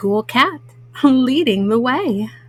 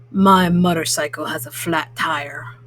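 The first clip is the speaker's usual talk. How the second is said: much lower grumpy toad voice; 9 semitones lower than usual. Acted. Mom reading to kids, Grumpy Toad refuses, much lower and grumpy. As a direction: grumble it like a sulky old toad. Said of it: "says Grumpy Toad".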